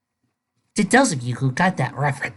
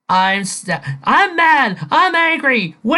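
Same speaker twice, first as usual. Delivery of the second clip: high, loud whiny tantrum; mock him throwing a fit; mock whiny Davis; 9 semitones higher, 4 dB louder, slower than usual. Reviewer mocking Davis's angry whining, high and loud. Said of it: "sees Davis going".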